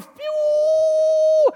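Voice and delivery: long, high, loud whoosh; whooshing current noise. Shown as a demonstration. Voice passing through the crowd like electric current, loud whoosh. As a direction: imitate a current shooting through, sustained and high. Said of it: "the electric current".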